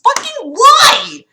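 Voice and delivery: high, slow, drawn-out why; exasperated cry. Real, not acted. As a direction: stretch out the why in disbelief. Exasperated at the story.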